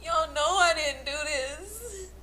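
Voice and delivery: high, loud, sobbing plea; crying protest. Real, not acted. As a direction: cry out your innocence. Defendant protests through tears.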